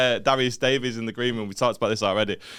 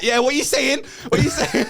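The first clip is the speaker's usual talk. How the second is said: very high, excited mock greeting; boisterous guest; 11 semitones higher, 3 dB louder than usual. Host imitates guest grabbing him backstage, boisterous and high. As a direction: imitate an over-hyped mate piling in.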